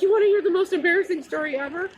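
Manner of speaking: high, loud and fast, bursting to tell; eager excited question. Retold or imitated. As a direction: pounce on a stranger with big news.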